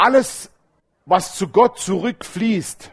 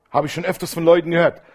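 ordinary talk

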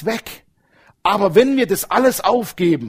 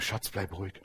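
softly quoting the wife, much quieter; a calm low "Schatz, bleib ruhig" from the wife; 9 semitones lower, 16 dB quieter than usual. Retold or imitated. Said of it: "meine Frau sagt". (die Ehefrau beruhigt ihn leise)

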